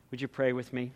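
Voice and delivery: ordinary talk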